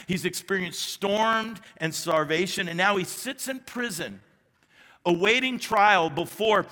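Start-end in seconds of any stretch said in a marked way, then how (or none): none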